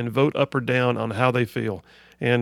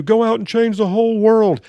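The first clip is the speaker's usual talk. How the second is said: higher, louder mock exhortation; raised-voice pretend pep talk; mock rallying call; 8 semitones higher, 5 dB louder than usual. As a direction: call it out like an overblown rallying cry.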